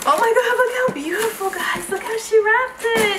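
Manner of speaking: high, gushing excitement; thrilled and marvelling; delighted awe. Real, not acted. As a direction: gush over a lovely gift, pitched high. Delighted at beautifully wrapped gift.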